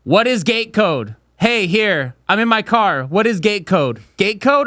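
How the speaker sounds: high, insistent demands; insistent delivery driver. Retold or imitated. Delivery driver messaging a customer for the gate code, insistent.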